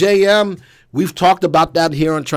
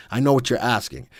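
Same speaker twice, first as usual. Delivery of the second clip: normal talking voice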